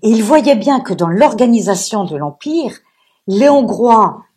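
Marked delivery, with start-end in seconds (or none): none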